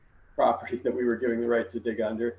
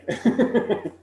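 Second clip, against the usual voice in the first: breathy laugh; 9 semitones higher, 4 dB louder than usual. Real, not acted. Laughs after joke.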